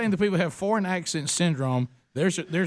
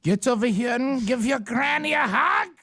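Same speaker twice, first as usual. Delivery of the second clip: slow, higher mock German grandma voice; mock German granny; 5 semitones higher than usual. Host plays German-speaking grandma greeting grandchild, slow mock German accent. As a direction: boom it out like a stern German granny.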